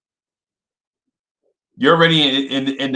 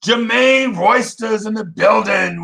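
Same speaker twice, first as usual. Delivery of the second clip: higher, slow hype call; announcer intro; 7 semitones higher than usual. Real, not acted. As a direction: bring him in like an MC. Announcing viewer arrival.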